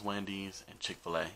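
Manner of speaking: ordinary delivery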